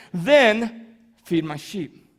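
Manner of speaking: slow, weighted delivery with a pause after "Then"; slow, restrained command; measured charge. Acted. Preacher gives closing line, then repeats.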